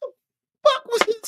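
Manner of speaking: disbelieving high-pitched outburst; high exasperated cry. Real, not acted.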